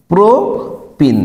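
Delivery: Propene stretched very slowly; slowly drawn out. Shown as a demonstration. Teacher showing students how the name sounds, very slow. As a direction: lay out propene slowly for copying. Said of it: "ডাবলে কি উচ্চারণ হবে?".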